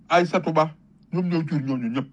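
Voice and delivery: normal talking voice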